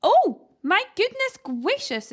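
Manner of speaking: high, loud posh exclamation; exclaiming toff. A narrator's reading. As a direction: gasp it out appalled. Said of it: "exclaimed Sir Fred".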